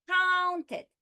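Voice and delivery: COUN in "counted" high, loud and held; stress on first syllable. Shown as a demonstration. Teacher giving an example word, COUN stressed in "counted". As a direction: push the start of "counted" up and out. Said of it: "on the first syllable".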